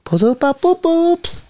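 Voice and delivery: very high, silly mock-French; slow falsetto French accent. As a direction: squeal the jingle in fake French. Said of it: "Can you do French?".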